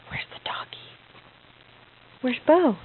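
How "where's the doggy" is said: fast excited whisper. Real, not acted. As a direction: gasp and whisper like a secret. Playful search with baby.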